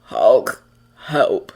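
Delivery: deep rumbling monster voice; rumbling Hulk. A narrator's reading. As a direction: rumble it low. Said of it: "the Titan rumbled".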